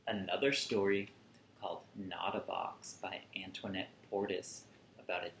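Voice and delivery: ordinary delivery, nothing marked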